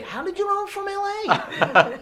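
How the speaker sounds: very high, slow, laughing and wide-eyed; mock tourist. Tourist amazed the speaker guessed LA, high mock voice. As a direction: squeal it like a delighted naive visitor. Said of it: "you must be, like, from L A".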